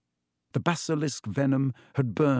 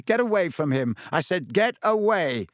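raised, higher-pitched voice, insistent; angry command; 6 semitones higher, 4 dB louder than usual. A narrator's reading. Villain driving the bird off, angrily insistent. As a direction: build to an angry cry.

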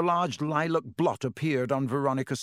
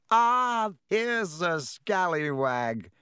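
higher, slow, drawn-out greeting; jovial pompous Lockhart; 6 semitones higher, much slower than usual. A narrator's reading. Lockhart greets Harry at his door, jovial and pompous. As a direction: hail a guest with showy delight.

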